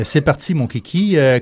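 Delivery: ordinary talk, nothing marked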